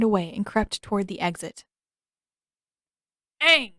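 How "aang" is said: sharp high call across the room; sudden shout. A narrator's reading. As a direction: bolt upright and yell his name. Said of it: "The shout came".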